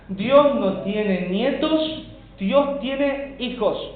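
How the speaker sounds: slow, high preaching with weight on "nietos" and "hijos"; emphatic contrast. Real, not acted. Preacher drives home a contrast.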